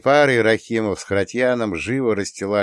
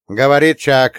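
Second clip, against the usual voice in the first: raised, higher radio-operator voice; radio call; 4 semitones higher, 7 dB louder than usual. A narrator's reading. Chuck radios the convoy, calling out crisply. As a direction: call in loudly over the radio. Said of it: "зашипела рация".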